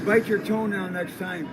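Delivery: loud mocking shout; loud taunt. Real, not acted. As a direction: jeer after him. Taunting the departing man.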